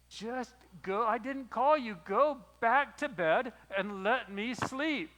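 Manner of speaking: tired father fed up at being woken; frustrated. Retold or imitated. Eli woken a second time, telling Samuel off in frustration. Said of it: "with a bit of frustration in his voice".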